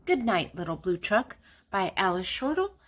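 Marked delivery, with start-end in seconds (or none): none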